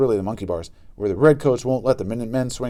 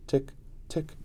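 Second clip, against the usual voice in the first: clock ticking; 4 semitones higher, 12 dB quieter than usual. A narrator's reading. Clock ticking in silent class.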